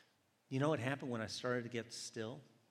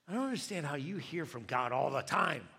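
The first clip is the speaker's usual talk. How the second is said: louder, exasperated complaint; exasperated challenge; 3 semitones higher, 6 dB louder than usual. Retold or imitated. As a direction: confront her in frustration. Retold confrontation with irritating sister.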